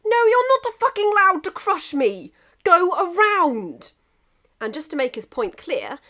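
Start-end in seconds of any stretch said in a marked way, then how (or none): 0.0-3.9 furious shout. Acted. England shouting at Russia to go around, furious. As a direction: lay down the law.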